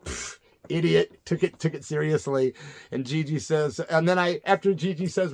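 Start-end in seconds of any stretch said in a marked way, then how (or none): 0.0-1.2 slow dismissive scoff. Retold or imitated. Scoffing at the heckler who took it seriously, slow and dismissive. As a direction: brush him off as a fool, slowly. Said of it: "I'm like".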